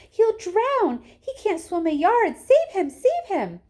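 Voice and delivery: high, loud frantic screams; frantic mother screaming for help; panicked screaming. Acted. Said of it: "screamed Missus Gloop".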